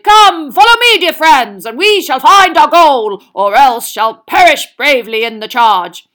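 rally the troops like a knight; shouting knight. A narrator's reading.

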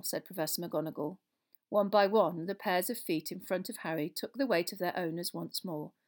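ordinary delivery

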